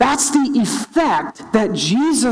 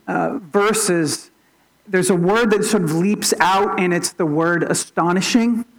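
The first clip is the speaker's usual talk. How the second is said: ordinary delivery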